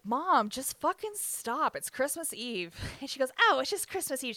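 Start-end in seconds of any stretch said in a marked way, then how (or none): 0.1-2.7 mildly raised, higher voice of an exasperated sister; exasperated plea. Retold or imitated. Sister pleading in parents' fight. 3.4-4.4 high, louder mimicry of someone's plea; mocking echo. Mom mockingly echoes the sister.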